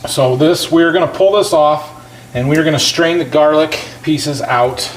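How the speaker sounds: normal talking voice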